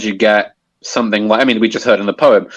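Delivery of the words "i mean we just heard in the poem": ordinary delivery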